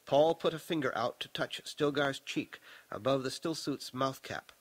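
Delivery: plain reading voice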